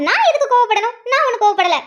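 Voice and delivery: wife loudly denies being angry; angry denial. Acted. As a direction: insist you're not angry while clearly angry. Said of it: "நீ கோவப்படுற".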